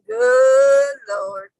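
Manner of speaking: a high, loud cry of "Good Lord"; yelled exclamation. Retold or imitated. Friend imitates shoppers crying out in shock at the scene. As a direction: shout it in mock shock. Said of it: "Y'all be yelling".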